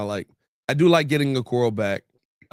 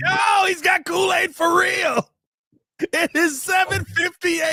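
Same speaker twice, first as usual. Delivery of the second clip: mock outrage. Real, not acted. Host teasing co-host's early-morning hype, mock outrage. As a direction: cry out in comic disbelief.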